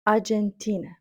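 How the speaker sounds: Аргентина as English ah-jen-TEE-na; English Аргентина, weight on the TEE syllable; stress on TI syllable. Shown as a demonstration. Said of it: "наголос на склад Т".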